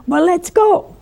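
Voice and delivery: high, loud, eager retold cry; excited. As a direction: play the thrilled man ready to set off. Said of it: "he was so excited".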